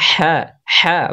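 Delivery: হ্যাঁ with a strong h from mid-throat; throat-made H. Shown as a demonstration. Teacher showing the articulation point, throaty h in হ্যাঁ. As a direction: push the h of হ্যাঁ out from the middle of the throat. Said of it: "কণ্ঠনালীর মাঝখান হতে উচ্চারিত হয়".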